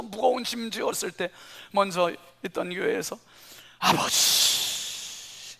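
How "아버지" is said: long breathy drawn-out whisper; tearful whisper. Retold or imitated. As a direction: let the word break into tears. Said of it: "눈물이 쏟아지고".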